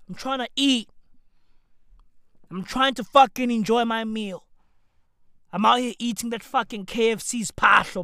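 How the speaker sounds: ordinary delivery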